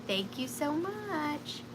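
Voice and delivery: soft, high mock Mom voice; a light take on Mom's thank you; imitating Mom. Seller quoting how Mom thanks buyers, softly. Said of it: "I know Mom would say".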